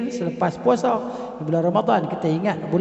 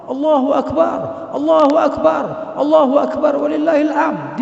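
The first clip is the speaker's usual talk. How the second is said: higher and louder, triumphant; joyful takbir; 8 semitones higher, 6 dB louder than usual. Shown as a demonstration. Teacher showing takbir as a celebration of victory, bright and loud. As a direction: call it out like a victory cry. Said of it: "ini sebenarnya kemenangan".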